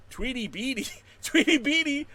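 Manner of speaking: high, loud gleeful repeat of the name; delighted name call. Real, not acted. Streamer relishing a viewer's funny name, delighted. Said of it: "that's a great name".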